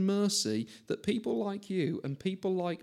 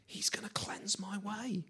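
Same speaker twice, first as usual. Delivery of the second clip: soft, slow, hushed whisper; whispered promise; 5 semitones higher, 9 dB quieter than usual. Acted. Preacher whispering God's promise to the congregation, slow and soft. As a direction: breathe the promise like a secret.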